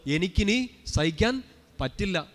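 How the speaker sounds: slow, drawn-out words with a lifted ending; saint's accepting tone. Retold or imitated. Preacher voicing the ailing saint's words to his congregation, slow and deliberate. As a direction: stretch each word out, ending on a rise. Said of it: "പക്ഷെ നമ്മൾ പറയുന്ന ടോണിലല്ല".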